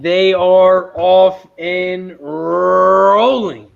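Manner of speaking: slow, drawn-out race announcer call. Acted. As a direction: call the start like a track announcer. Race caller starts the race.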